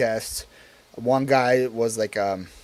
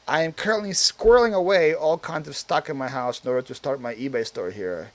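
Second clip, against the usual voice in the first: usual speaking voice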